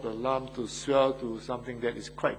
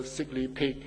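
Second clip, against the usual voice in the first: normal talking voice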